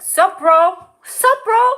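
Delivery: loud, high, exaggerated sup-bro call; cousin's bro greeting. Cousin Sandy out of prison, loud hammed-up greeting. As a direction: bellow it as a mock bro hello. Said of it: "She comes out like".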